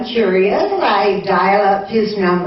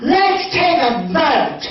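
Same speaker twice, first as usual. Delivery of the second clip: public announcement; 4 semitones higher than usual. Retold or imitated. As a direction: put it to every diner in the restaurant. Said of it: "saying to probably everyone in the restaurant hearing".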